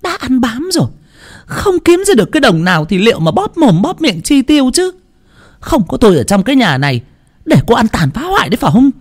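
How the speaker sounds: very high, fast, bitter scolding; hissing rebuke. A narrator's reading. Mother-in-law bitterly rebukes her daughter-in-law. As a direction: spit the insult at her. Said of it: "gằn lên chì chít".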